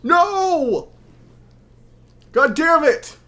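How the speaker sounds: high, anguished cry of no; anguished shout. Real, not acted. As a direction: yell it in despair. Attack misses in boss fight.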